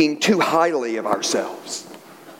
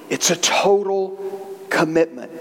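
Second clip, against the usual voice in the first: solemn restatement; 2 semitones lower than usual. Real, not acted. Preacher turning joke into point for friends, solemn. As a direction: break it up and let it land gravely.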